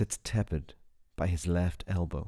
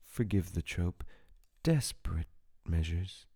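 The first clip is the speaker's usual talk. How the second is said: plain reading voice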